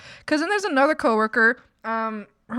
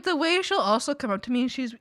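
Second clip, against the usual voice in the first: ordinary delivery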